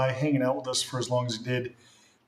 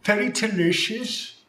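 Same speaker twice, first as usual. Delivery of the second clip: pitched-up fake Australian accent; playful fake Australian accent, much higher; high mock Aussie voice; 8 semitones higher than usual. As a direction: chime in with a silly Aussie voice. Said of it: "is that still Aussie".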